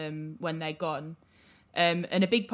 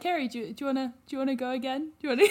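higher, hurried mock of a hesitant co-host; teasing imitation; 7 semitones higher, much faster than usual. Host teasing co-host about spacing songs out, mock-hesitant. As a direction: mimic their eager asking, pitched up and laughing. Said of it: "You're like".

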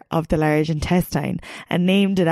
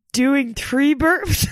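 trying not to laugh; 7 semitones higher, much slower than usual. Real, not acted. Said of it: "I can't even look at you".

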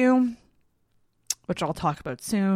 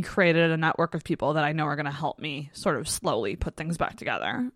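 ordinary talk

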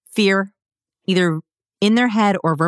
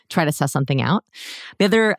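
ordinary talk

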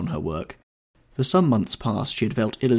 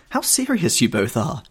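higher, louder cry of surprise; girlish exclamation; 6 semitones higher, 5 dB louder than usual. A narrator's reading. Sister running in on mother and brother, exclaiming. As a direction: exclaim it lightly, pitched up. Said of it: "she cried".

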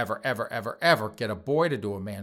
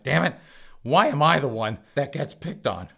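put-on whine of protest, louder; mock aggrieved; 3 semitones higher, 6 dB louder than usual. Niece told to stop using her aunt, mock aggrieved. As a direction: imitate someone complaining they're singled out.

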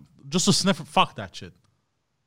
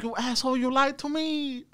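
put-on botched Russian accent; 12 semitones higher, 4 dB quieter than usual. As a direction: squeal the accusation in a bad put-on foreign accent.

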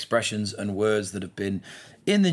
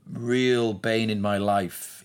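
ordinary talk

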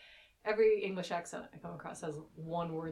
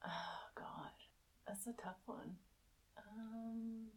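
whisper; 3 semitones higher, 13 dB quieter, much slower than usual. Real, not acted. Thinking over best date.

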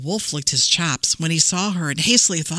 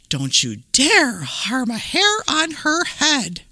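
stern granny warning; 7 semitones higher than usual. Acted. Gran confronts the wolf.

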